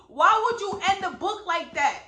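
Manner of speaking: venting real frustration at the author; mad at the book. Real, not acted. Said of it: "I'm still so mad".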